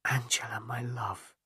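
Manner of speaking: soft, hushed whisper of her name; tender whisper. A narrator's reading. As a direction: breathe the words to her alone. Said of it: "he whispered".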